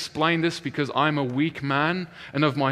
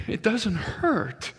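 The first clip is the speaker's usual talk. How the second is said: high, slow, wondering imitation; amazed discovery; 8 semitones higher, much slower than usual. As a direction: act out slowly dawning disbelief. Acts out healed man's astonishment.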